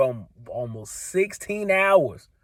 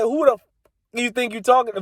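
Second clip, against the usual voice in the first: indignant shout; 8 semitones higher, faster than usual. Retold or imitated. Retold outburst.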